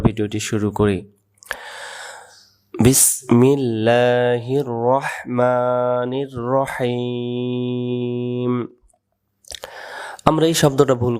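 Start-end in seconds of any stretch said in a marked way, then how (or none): 2.7-8.5 slow, drawn-out tajweed chant; Quran recitation. Shown as a demonstration. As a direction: stretch every vowel like a recitation.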